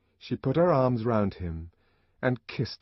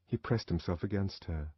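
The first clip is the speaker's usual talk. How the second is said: ordinary delivery, nothing marked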